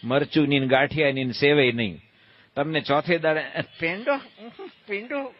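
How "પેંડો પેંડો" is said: mock disgust at sweets.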